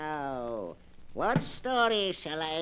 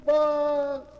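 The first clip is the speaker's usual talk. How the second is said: slow, booming, louder announcer call; dramatic title announcement; 6 semitones higher, 7 dB louder, much slower than usual. Acted. Announcer opening the radio mystery show, slow and booming. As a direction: stretch the title out grandly.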